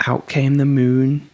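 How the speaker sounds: plain reading voice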